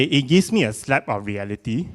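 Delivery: usual speaking voice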